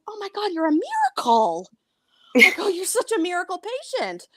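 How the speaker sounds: high-pitched, gushing fake wonder; mock amazement. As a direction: act awestruck by a claim you don't believe. Retold mocking of miracle claim.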